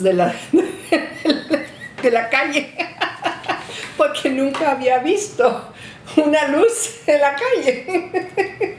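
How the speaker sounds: high, giggling through the words; can't stop laughing at the memory. Real, not acted. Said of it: "laughing while telling memory".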